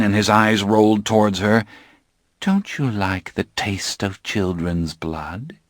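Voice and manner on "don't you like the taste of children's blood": soft, slow and sly; softly. Acted. Claudia asks her poisoned father, soft and slow. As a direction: ask it softly, almost sweetly, and slowly. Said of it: "she asked softly".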